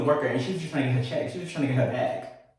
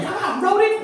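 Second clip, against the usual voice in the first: mock scream. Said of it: "but I was screaming".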